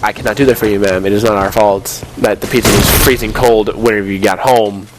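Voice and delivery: normal talking voice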